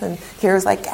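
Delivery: normal talking voice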